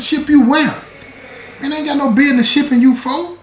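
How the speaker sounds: high, fast, indignant called-out reply; reacting to a friend being shipped, called out high. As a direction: push back hard on the news. Indignant reply shouted across.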